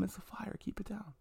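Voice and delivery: hushed, breathless whisper to mom; soft whispered hush; retold whisper. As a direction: whisper it so mom keeps quiet. Sick kid hushing mom about fire.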